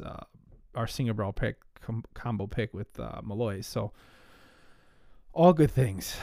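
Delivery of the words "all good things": winded, catching breath. Real, not acted. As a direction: speak while short of breath. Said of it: "I get myself out of breath doing this".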